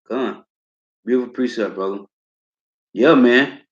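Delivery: normal talking voice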